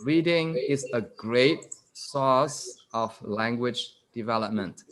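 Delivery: slow, deliberate teacher's read-aloud; measured, spaced-out reading for learners; reading slowly. Shown as a demonstration. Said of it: "I'm going to read this slowly".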